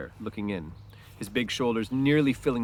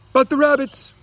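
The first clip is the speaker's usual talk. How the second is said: much higher, loud excited cry; near shout; 11 dB louder than usual. A narrator's reading. Lenny almost shouting to Crooks about the rabbits. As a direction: burst out with childlike excitement. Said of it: "Lenny almost shouted".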